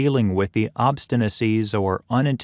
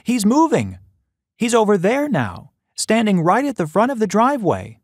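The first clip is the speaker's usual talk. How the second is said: much higher, louder anxious warning; nervous; 11 semitones higher, 3 dB louder than usual. Acted. Mother warning her son the man is moving, much higher and anxious. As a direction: track the man urgently. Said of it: "Mom said nervously".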